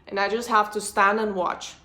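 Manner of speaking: usual speaking voice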